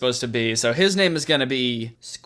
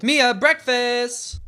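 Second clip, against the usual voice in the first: higher, slow sing-song call; calling out to breakfast; 8 semitones higher, much slower than usual. Acted. Mom calls Mia to breakfast, drawn-out sing-song call. Mum calling daughter from downstairs.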